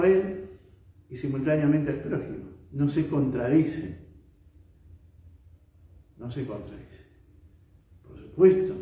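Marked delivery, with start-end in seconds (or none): 2.7-4.1 slow, full-voiced "No se contradice"; unhurried, plainly audible assertion; measured statement. Real, not acted. Preacher makes point, then repeats it. 6.2-7.0 low, much softer "No se contradice"; quiet, lowered echo of the point; hushed repetition. Real, not acted. Repeats the point after a pause.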